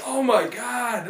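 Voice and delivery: stunned listener reacting; mock shocked. Overhearing the fight next door, drawn-out shock. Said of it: "I'm like".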